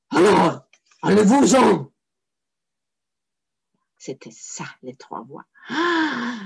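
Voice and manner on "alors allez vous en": loud, booming get-out; big scary devil voice. Acted. Third devil orders the saint away, loud and menacing. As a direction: chase them off like a monster.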